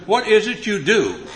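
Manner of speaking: high, loud, slow exasperated demand; frustrated. Retold or imitated. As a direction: spell it out in exasperation. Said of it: "Now I'm really getting frustrated".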